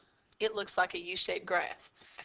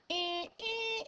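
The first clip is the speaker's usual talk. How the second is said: high squeaky "ee, ee" like something sliding up; playful high-pitched sliding noise; rising squeak effect; 13 semitones higher, 8 dB quieter than usual. Shown as a demonstration. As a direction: squeak it up as if the graph lifts. Mimicking the graph moving up.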